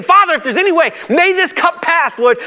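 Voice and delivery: Jesus praying in the garden, anguished; anguished prayer. Acted. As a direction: plead with the Father. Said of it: "he goes and prays again".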